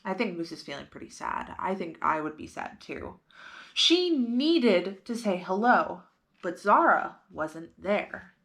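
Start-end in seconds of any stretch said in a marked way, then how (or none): none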